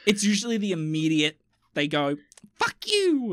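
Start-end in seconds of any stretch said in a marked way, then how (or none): 2.6-3.3 high-pitched mock cursing; mock angry outburst. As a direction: act out a sudden furious yelp. Acted angry waking partner's outburst.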